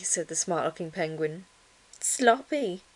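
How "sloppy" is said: high, mocking one-word verdict; jeering. A narrator's reading. Chimpanzee mocking Ruby, jeering. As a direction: toss out the insult.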